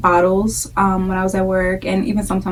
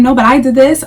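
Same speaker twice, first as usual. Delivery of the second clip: raised, louder imitation of a rival mom; mock one-upping; 5 semitones higher, 6 dB louder than usual. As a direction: butt in to top her story. Acted rival mom contradicting the first.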